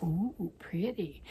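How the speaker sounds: slow soft admiring ooh; slow, dreamy coo over the yarn. Retold or imitated. Quoting own yarn admiration.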